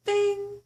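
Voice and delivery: high, loud "bing" like a seatbelt chime; imitated chime. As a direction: ring it out like the cabin bell.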